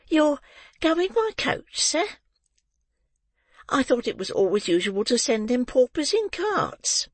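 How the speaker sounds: put-on high, wondering old woman; high, awed matron voice; awed landlady. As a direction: ask in timid surprise. Mrs Mann, awed, asks.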